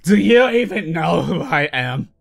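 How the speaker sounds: slow, pompous put-on voice; mock egotistical. Inside joke with friends, slow mock-arrogant put-on voice. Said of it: "the most egotistical person".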